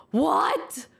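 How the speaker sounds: high, sharp burst of disbelief; shocked. Retold or imitated. Co-worker hearing friend's pregnancy news, shocked. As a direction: blurt it out in shock.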